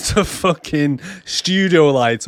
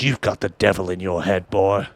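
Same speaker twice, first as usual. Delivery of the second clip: low, soft menacing preacher voice; stern preacher; 7 semitones lower, 3 dB quieter than usual. Retold or imitated. The zealous father threatens Job with the belt: low, soft, menacing.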